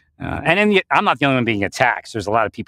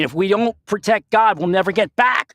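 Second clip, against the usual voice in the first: urgent command; 8 semitones higher than usual. Retold or imitated. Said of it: "And I'm saying".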